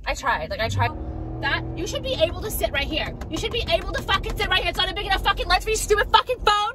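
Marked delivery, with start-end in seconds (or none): 1.4-6.7 much higher exasperated venting; venting at the phone mount, pitch far higher; frustrated outburst. Real, not acted.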